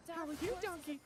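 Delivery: high, sweet princess voice. Pretend. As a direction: chirp it like a Disney princess.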